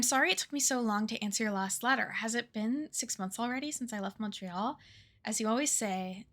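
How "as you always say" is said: usual speaking voice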